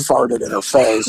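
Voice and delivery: ordinary talk, nothing marked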